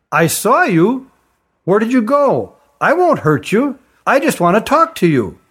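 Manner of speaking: put-on young girl coaxing a hidden stranger out; little girl voice. Little girl calls to the hiding Kirin, coaxing.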